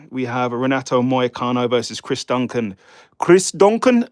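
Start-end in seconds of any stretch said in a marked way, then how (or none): 3.2-4.1 put-on a quick, high, loud stab at a Scottish accent; hammed-up Scottish accent on a fighter's name; poor Scottish accent. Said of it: "that is a poor Scottish accent".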